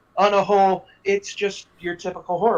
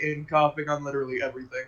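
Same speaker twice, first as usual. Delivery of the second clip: ordinary delivery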